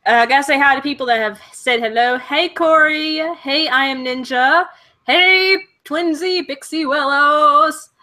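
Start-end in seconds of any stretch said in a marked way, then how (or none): 2.2-7.9 slow, singsong hellos; drawn-out greeting. Real, not acted. Streamer saying hi to people who said hello, singsong. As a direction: stretch out each name as you greet. Calling out hellos to live viewers.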